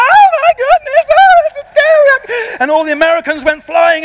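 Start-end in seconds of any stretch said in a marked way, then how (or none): 0.0-2.6 very high, loud imitated shriek; hammed-up terrified tourist lady screaming; mock frightened scream. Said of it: "and she was like".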